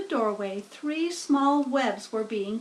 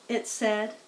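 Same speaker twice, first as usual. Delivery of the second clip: usual speaking voice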